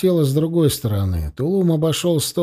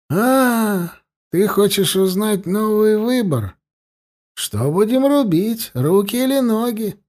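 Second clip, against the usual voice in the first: higher, coolly taunting captor; higher voice, a touch of mockery; lightly voiced tormentor; 7 semitones higher than usual. A narrator's reading. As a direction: offer the grim choice lightly.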